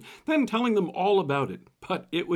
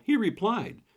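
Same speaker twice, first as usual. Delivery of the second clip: ordinary talk, nothing marked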